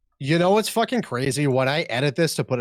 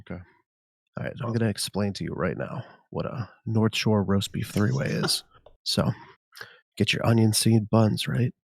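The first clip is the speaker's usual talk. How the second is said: ASMR voice. Pretend. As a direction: savour every word like a seductive ASMR whisperer. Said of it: "he said the first three words and he moaned".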